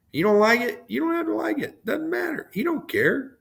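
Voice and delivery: high shrugging don't-care voice; defiant mock voice. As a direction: brush off the haters in a high mocking voice. Defiant mock voice for Russell Brunson.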